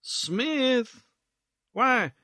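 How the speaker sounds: languid, drawn-out gentleman; drawled syllables. Acted. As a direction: let each word hang and slide. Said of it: "drawling out his syllables".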